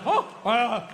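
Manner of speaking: faltering, hesitant mock bafflement; feigned clueless stammer; mock dumbfounded. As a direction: play a sports host pretending not to know. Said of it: "sit there, like, dumbfounded".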